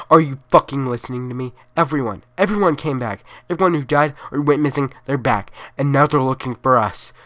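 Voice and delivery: loud, fast desperate yell; frantic yelling. Acted. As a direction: shout it at the officer in panic. Caller yells at officer.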